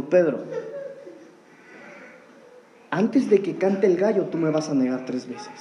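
soft, sorrowful low voice as Jesus; a touch of sorrow, dropping low at the end; sad. Retold or imitated. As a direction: tell him gently, with grief. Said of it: "yo me imagino que con tristeza".

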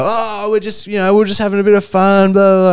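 very high, loud put-on voice brushing it off; mock jovial excuse. Crackheads laughing off the attack, mock jovial. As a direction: mimic troublemakers playing it down as a joke. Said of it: "they started laughing".

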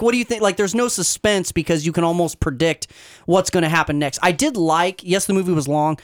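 normal talking voice

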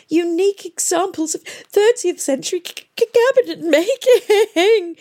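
very high, stammering tearful wail. A narrator's reading. Miss Plim mourns the lost furniture, wailing in tears. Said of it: "wailed Miss Plim".

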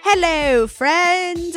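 ordinary talk

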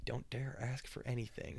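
soft stern whisper. Retold or imitated. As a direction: warn under your breath. Mom hissing warning in store.

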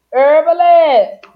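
drawn-out loud high call; calling a name. Real, not acted. Host calls out to a guest, slow and loud. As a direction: stretch the name out like a shout-out.

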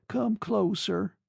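high, slow, drawn-out invitation; tender coaxing. A narrator's reading. Woman drawing her lover to her side, tender coaxing. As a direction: coax softheartedly, lingering on the words.

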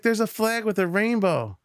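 put-on high, bright-eyed child announcing a discovery; little girl voice. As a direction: play the four-year-old, pitched high. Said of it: "she's like".

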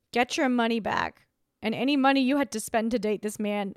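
ordinary delivery

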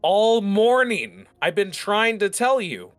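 high, loud exasperated outburst. Acted. Servant who kept trying to tell master, exasperated. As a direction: stretch the first words out in high-pitched frustration.

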